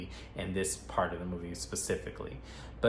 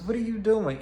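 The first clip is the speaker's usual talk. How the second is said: suspicious demand; 12 semitones higher, 5 dB louder than usual. Retold or imitated. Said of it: "And he goes".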